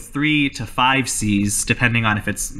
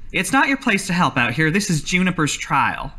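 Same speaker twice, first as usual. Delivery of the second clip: lightly voiced stern overseer, higher; mildly scolding, pitched up; 6 semitones higher than usual. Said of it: "looks at you with a stern expression".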